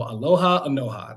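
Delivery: Anoha matched to the sound of Aloha; name pronunciation demo. Shown as a demonstration. Candidate repeating the rhyme for the audience, demonstrating Anoha's pronunciation. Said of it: "you were to say".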